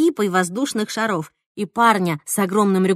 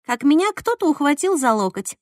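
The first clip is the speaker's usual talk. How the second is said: plain reading voice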